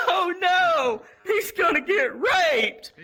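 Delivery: high, loud, slow mock sob-yell; panicked crying shout. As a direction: wail it like a frantic friend. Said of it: "she was like".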